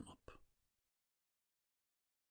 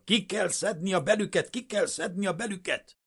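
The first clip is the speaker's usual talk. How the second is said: very high, loud screech; frenzied screeching; 13 semitones higher, 6 dB louder than usual. A narrator's reading. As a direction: shriek it over and over like a madwoman. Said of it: "egyre esztrikácsolta".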